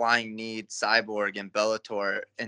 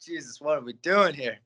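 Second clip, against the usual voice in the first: mock dismay; 4 semitones higher, 4 dB louder than usual. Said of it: "I was just".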